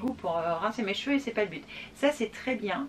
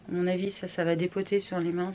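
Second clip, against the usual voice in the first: usual speaking voice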